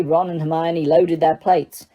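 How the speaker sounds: ordinary delivery, nothing marked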